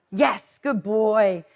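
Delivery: slow, drawn-out praise for the dog; praising the dog. Shown as a demonstration. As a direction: stretch out the praise, warm and pleased. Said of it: "I'm gonna show you how that's done".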